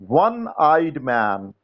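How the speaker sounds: very slow, word by word: "one eyed man"; teacher dictating an exercise slowly; slow dictation. Shown as a demonstration. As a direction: dictate each word for the class to write. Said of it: "खाली स्थान".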